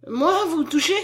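high, loud horrified question. A narrator's reading. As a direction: recoil in horror as you cry it out. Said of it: "s'écria-t-elle avec horreur".

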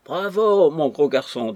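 high, loud bravo that drops back; mock cheering. A narrator's reading. An onlooker applauds the fat drunk singer, cheering. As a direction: cheer on a tipsy singer from the crowd.

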